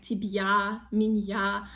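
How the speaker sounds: тебя and меня split as "tib-ya, min-ya"; added Y sound, wrong. Shown as a demonstration. Teacher showing learners' mistake, extra Y in меня. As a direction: say меня with a separate Y before the A. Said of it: "it's also not correct".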